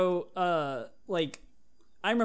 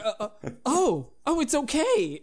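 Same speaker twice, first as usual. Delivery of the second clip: mock reassurance; 11 semitones higher, 7 dB louder than usual. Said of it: "they'd be like".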